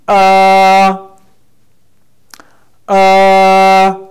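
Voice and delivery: long, high, level "Uh"; first tone, level. Shown as a demonstration. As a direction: sustain "Uh" loudly without moving the pitch. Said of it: "The first one we say".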